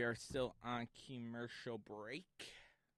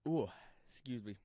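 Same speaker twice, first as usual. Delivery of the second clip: talking while burping. Real, not acted. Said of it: "I'm burping".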